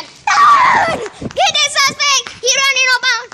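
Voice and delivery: high, loud, excited yell; chase shout. Pretend. Police officer launching a pursuit of suspect, shouting high.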